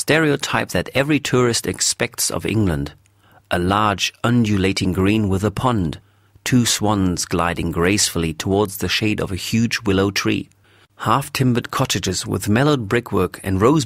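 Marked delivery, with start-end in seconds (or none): none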